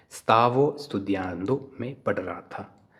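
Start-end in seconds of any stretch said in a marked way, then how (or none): 0.1-1.6 very slow, syllable by syllable; slow pronunciation demo. Shown as a demonstration. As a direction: draw out stavo studiando for learners. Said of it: "उसको कैसे pronounce करना है".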